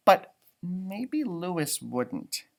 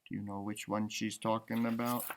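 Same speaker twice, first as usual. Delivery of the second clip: soft question to the listeners; quiet question; 7 semitones lower, 12 dB quieter than usual. Real, not acted. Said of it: "reader asks the listeners quietly".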